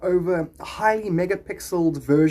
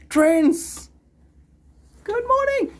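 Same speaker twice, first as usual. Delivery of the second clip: high greeting; 4 dB louder, much slower than usual. Real, not acted. Greeting a viewer.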